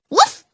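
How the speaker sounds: high, sharp woof; dog bark. Acted. Prince the dog barks at the snow, high yap.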